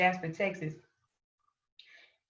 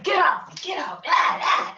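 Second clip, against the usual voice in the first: high strained shouts of get off, much higher; retold struggle shout; 10 semitones higher, 5 dB louder than usual. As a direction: reenact fighting them off, yelling. Shaking off police in driveway.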